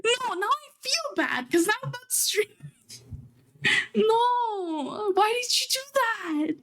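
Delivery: high, stunned disbelief at a gift; overwhelmed streamer reacting to an unexpected present; real surprise. Real, not acted. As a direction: squeal out wait, are you serious, caught off guard. Viewer sends a surprise gift.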